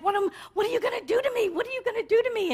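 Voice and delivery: high, fast frightened pleading; frightened dreamer begging, high and quick; panicked plea. Retold or imitated. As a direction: cower and beg the monster in a high rush. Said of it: "and he's like".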